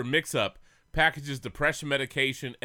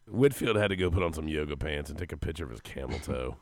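low rough gravelly voice; deep boozy growl; whiskey voice; 14 semitones lower, 4 dB quieter than usual. Retold or imitated. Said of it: "listen to my voice".

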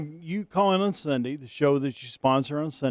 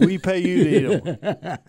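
laughing; 2 semitones higher, slower than usual. Real, not acted. Repeating a joke, laughing.

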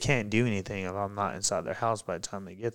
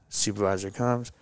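normal talking voice

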